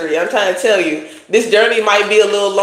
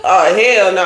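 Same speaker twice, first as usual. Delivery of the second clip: ordinary talk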